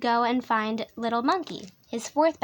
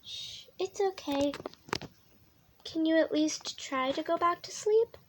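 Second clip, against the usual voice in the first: soft, higher comforting voice; soothing; 4 semitones higher, 6 dB quieter than usual. Acted. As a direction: hush a frightened child gently. Soothing a frightened child.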